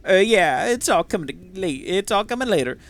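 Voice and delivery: a hint of southern twang on a smarmy schemer; smarmy southern drift. Broke tinkerer promising to pay next time, smarmy southern drift. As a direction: oily voice gone a little southern. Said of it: "it kinda got a little southern".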